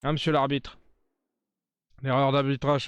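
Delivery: ordinary delivery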